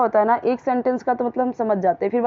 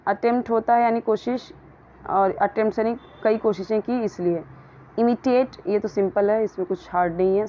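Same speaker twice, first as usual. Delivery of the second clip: ordinary talk, nothing marked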